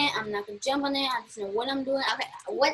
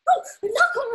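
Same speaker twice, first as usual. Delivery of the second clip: mock not calm; 9 semitones higher, 5 dB louder than usual. Game: says what S1 does.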